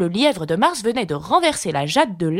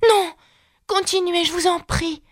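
humble; 11 semitones higher than usual. A narrator's reading. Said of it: "se faisant humble".

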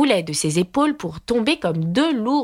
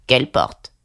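put-on old frog; 6 semitones lower than usual. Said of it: "Elle parlait si lentement".

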